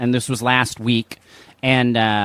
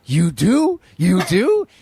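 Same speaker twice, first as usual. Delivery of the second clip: higher-pitched, astonished double question; retold surprise, pitched up; imitated amazement; 7 semitones higher, much slower than usual. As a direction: act out a stranger's happy disbelief. Retold stranger's amazed reply.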